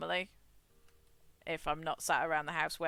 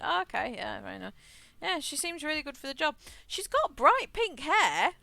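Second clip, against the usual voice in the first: playful imitation of a soft Irish accent, rising higher; quoting the new hire in a light Irish lilt; 7 semitones higher than usual. Telling her manager about the new hire, in a soft Irish accent. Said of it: "she's got a very, very soft Irish accent".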